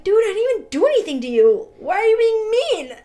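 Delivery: hammed-up hurt complaint, quick and loud; playing up her hurt outrage; indignant retort. Retold confronting rude people.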